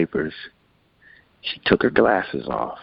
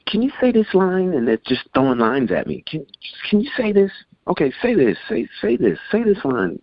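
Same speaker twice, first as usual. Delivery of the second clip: higher, lightly voiced eager producers; rapid producers; 12 semitones higher than usual. Producers throwing lines at the singer, lightly voiced higher. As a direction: toss out quick demands, a hint of excited producers.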